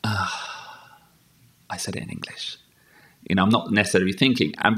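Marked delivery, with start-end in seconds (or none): 0.0-2.6 whispered self-reproach. Retold or imitated. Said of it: "I'm like".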